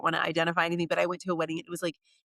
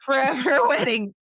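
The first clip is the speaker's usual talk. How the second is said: laughing; 6 semitones higher, 6 dB louder than usual. Real, not acted. Repeating the term, laughing.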